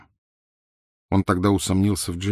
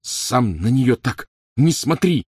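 slow, raised-pitch snarl; angry growl; 5 semitones higher, 4 dB louder, slower than usual. A narrator's reading. Ron defending Hermione from Harry, growling. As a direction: growl it back word by word. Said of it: "прорычал Рон".

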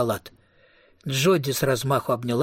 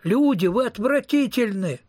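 high-pitched outcry, mildly raised volume; acted cry; 8 semitones higher than usual. A narrator's reading. Man crying out at disgusting passers-by, mild acted shout. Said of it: "крикнул Томми".

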